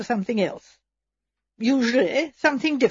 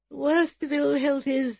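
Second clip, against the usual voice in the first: thin, weak, higher voice; melancholy invalid; 5 semitones higher than usual. A narrator's reading. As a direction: sigh it out like a self-pitying invalid. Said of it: "said Miss Emily in a melancholy tone".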